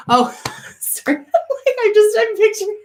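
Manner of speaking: breaking up mid-sentence; laughing. Real, not acted. Cracking up at the image of dad on a swing.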